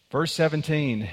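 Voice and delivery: ordinary delivery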